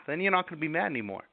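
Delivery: ordinary delivery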